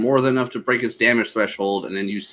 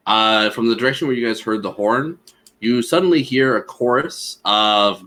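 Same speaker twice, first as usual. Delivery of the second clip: ordinary talk, nothing marked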